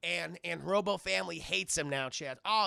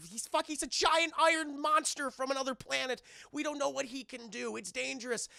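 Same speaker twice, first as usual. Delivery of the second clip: high, agitated acted warning; alarmed warning; 7 semitones higher than usual. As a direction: imitate a man urgently warning a boy. Man warning boy, retold.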